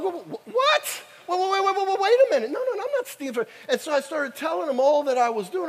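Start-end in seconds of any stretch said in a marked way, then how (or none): 0.0-3.5 high, stammering disbelief, slowed; reliving the panic at the accusation; shocked protest. Retold or imitated. Preacher to customs lady, shocked high stammer.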